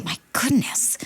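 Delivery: whispered, appalled aside; soft, airy exclamation of dismay; hushed dismay. Acted. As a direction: whisper it under your breath, aghast. Aghast aside after imitating his screams.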